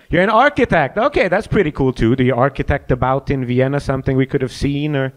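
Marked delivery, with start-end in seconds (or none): 3.3-5.1 haughty little somewhat with a rise; smug questioning somewhat; arrogant. Real, not acted. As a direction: say it like you're above it all. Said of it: "the most arrogant somewhat I ever heard".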